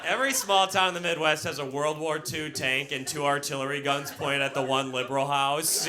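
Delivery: usual speaking voice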